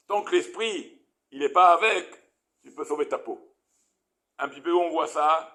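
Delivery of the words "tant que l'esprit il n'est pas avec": usual speaking voice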